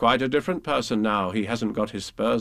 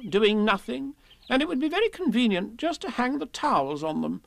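higher, chattering, a bit pleased; fussy Rabbit; 9 semitones higher than usual. Acted. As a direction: Rabbit nattering about his towels. Rabbit voiced chattering at Pooh.

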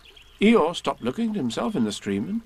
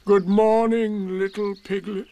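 Eeyore, glum; 8 semitones higher, 4 dB louder than usual. A narrator's reading. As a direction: drag the greeting out, raised in pitch. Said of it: "said Eeyore".

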